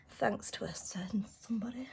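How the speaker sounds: pointed whispered jab. Real, not acted. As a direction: whisper it with a sly dig. Jab at the dog.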